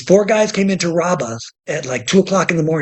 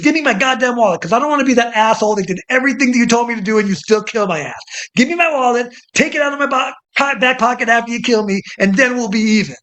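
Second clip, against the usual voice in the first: mock defiant hostage; 6 semitones higher than usual. Retold hostage defiance, voiced higher.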